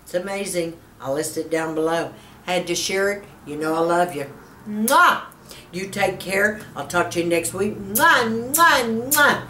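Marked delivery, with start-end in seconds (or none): 4.7-5.2 loud smacking air kiss. Real, not acted. Blowing kisses to viewers. 7.8-9.4 rising smacking air kisses. Real, not acted. Blowing kisses to viewers.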